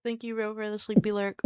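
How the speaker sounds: ordinary talk, nothing marked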